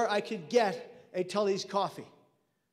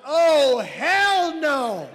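huge, high, drawn-out yell; yelled refusal; 6 semitones higher, 10 dB louder, much slower than usual. Retold or imitated. Margaret refuses to take Kevin in, yelling it out. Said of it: "And she yells out".

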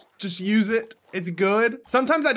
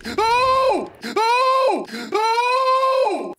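high, loud scared cries; startled shrieks; 8 dB louder, much slower than usual. Real, not acted. Streamer shrieking after a jump scare.

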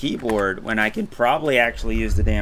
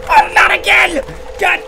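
high loud wail of frustration; exasperated shout; 7 dB louder than usual. Real, not acted. Player yells at dying yet again, high and loud. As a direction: yell in disbelief.

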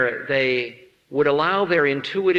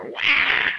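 high unvoiced mock baby wail; 4 dB louder than usual. Baby crying at the holy man, a high mock wail. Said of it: "They go".

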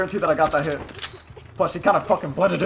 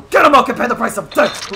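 higher mock battle cry, loud at the start; shouted catchphrase; 4 semitones higher, 7 dB louder than usual. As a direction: holler it as a taunt. Shouted catchphrase during play.

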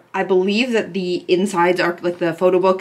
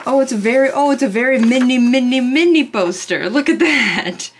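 high, louder burst of amused delight; delighted surprise; 5 semitones higher, 5 dB louder than usual. Real, not acted. Fan discovers a tiny mini poster, delighted surprise. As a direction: exclaim in a raised, excited voice.

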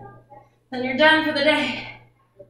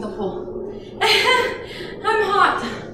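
laughing; 6 semitones higher, much slower than usual. Real, not acted. Laughing about the heat.

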